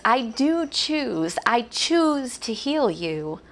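very slow, gentle declaration; slow and deliberate. Acted. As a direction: say it slowly, tenderly. Jesus quoted, very slow.

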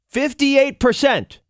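fifty-eight percent stretched high and slow; emphatic stress. Real, not acted. Repeating the shock statistic.

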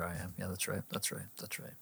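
muttering quietly. Real, not acted. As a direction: trail off under the breath. Fading mumble.

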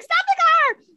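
high, loud whiny wail; loud whiny mock shriek; whiny person. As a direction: whine like a panicky passenger. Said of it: "he sounds like a whiny person in the back".

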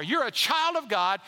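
usual speaking voice

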